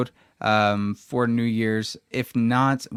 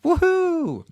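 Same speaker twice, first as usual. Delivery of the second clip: cheer; 4 dB louder than usual. Real, not acted. Mock celebration of shared childhood.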